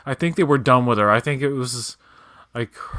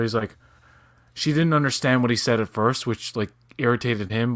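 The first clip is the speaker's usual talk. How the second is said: usual speaking voice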